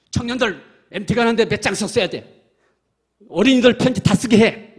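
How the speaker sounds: retold stern command, rising to a shout; pastor scolding. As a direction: imitate the pastor laying down the law. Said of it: "이거 막 호통쳐요".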